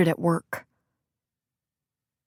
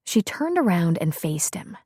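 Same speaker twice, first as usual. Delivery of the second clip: ordinary delivery, nothing marked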